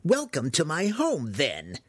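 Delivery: high, gloating wizard's welcome; triumphant yell. Acted. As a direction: crow it high, dropping on the last word. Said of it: "the wizard yelled triumphantly".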